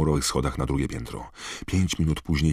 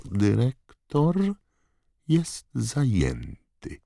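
very slow, higher-pitched taunt; drawling Snape; 10 semitones higher, 3 dB louder, much slower than usual. A narrator's reading. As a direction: draw out the refusal, savouring it. Snape coldly refuses Harry's plea. (przeciągła, złośliwa odmowa)

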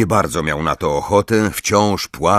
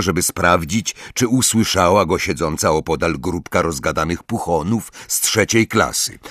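usual speaking voice

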